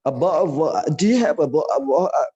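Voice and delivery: hammed-up British accent, half gibberish; mock British accent. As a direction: garble it in exaggerated British. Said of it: "British itu yang paling susah".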